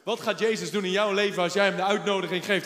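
ordinary delivery

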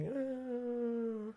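long, soft, high-pitched "eh"; drawn-out "eh". Shown as a demonstration. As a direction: stretch out a quiet, held groan of reluctance. Noncommittal noise mocking all the involvement.

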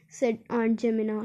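ordinary delivery, nothing marked

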